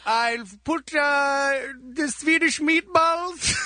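put-on comic Swedish accent. Radio comic as Johnny Sweden for the hosts, Swedish accent. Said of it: "it's Johnny Sweden".

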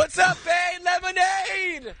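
high-pitched holler at a star overhead; shrieky mock fan call, drawn out; yelling fan. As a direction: yell it up to a passing plane, stretching the last word. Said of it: "that's not what you wanna yell".

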